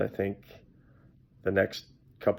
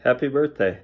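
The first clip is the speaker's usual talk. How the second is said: excited; 6 semitones higher, 5 dB louder than usual. Real, not acted. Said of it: "I'm excited to celebrate".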